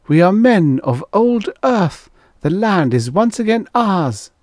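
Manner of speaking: high, exultant declaration; triumphant cry. A narrator's reading. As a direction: proclaim it high, claiming the land. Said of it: "cried Finn".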